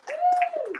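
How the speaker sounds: high-pitched whoop; crowd cheer. Real, not acted. Comic leading applause for the crowd, high cheer. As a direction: let out a long, high "woo".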